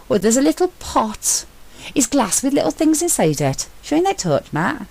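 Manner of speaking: an eager explorer calling up from a hidden chapel; Sentry's excited voice. A narrator's reading. Sentry describing the pot.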